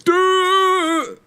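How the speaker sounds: exaggerated high, loud mock actor voice; David Harbour's Hellboy. Podcaster mocking an actor's Hellboy voice, hammed-up loud impression. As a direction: blurt one long, strained high note as a mocking impression. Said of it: "that was his voice for most of the movie".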